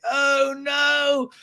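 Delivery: high-pitched melodramatic wail; hammed-up whiny lament, pitched high; mock moaning. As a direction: moan it out in exaggerated despair. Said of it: "James moaning, like".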